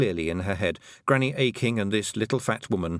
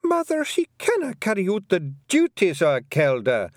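higher pixie daughter, protesting in Scots; 11 semitones higher, 5 dB louder than usual. A narrator's reading. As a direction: object to her mother, indignant. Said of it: "Fionn protested".

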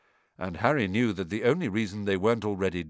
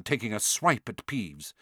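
ordinary delivery

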